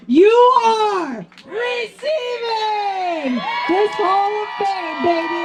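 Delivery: high, slow, crying out the news; real tears breaking through a joyful shout; tearful shout. Real, not acted. Speaker announces teammate's Hall of Fame induction, crying shout.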